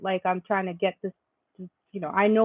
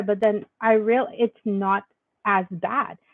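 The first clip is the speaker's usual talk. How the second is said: ordinary delivery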